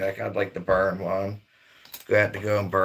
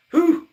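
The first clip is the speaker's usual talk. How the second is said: whistle; 8 dB louder than usual. Pretend. Reacting to the cold.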